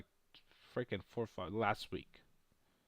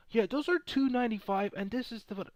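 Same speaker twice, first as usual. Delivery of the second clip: imitating cashier; 9 semitones higher than usual. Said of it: "He was like".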